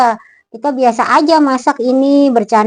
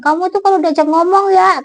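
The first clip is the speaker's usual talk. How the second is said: higher, exasperated scolding; scolding relative; 9 semitones higher, 3 dB louder than usual. Retold or imitated. Relative scolding the absent ghost-seer, exasperated and higher. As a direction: mimic the relative nagging. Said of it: "kata saudara saya gitu".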